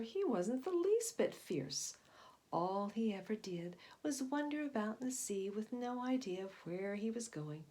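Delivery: put-on lively, loud read-aloud voice for kids; storybook narration. A narrator's reading. Teacher reading a sea serpent story to children, animated narration. Reads picture book aloud.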